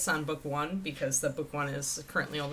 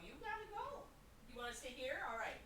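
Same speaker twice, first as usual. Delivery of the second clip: soft dog talk; 6 semitones higher, 15 dB quieter, faster than usual. Real, not acted. Shooing the dog.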